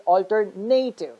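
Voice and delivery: alternative as alter-NAY-tive; stress on third syllable. Shown as a demonstration. Teacher explaining why it comes out wrong, alternative as alter-NAY-tive. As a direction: put the weight on NAY in alternative. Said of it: "stressing the third syllable".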